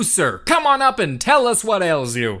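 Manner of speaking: normal talking voice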